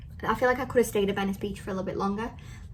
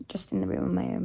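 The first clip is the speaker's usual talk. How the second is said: normal talking voice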